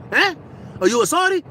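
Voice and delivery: put-on very high, incredulous Italian accent; startled mock Italian query; imitated Italian driver. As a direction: question it like a hammed-up Italian. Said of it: "He's Italian".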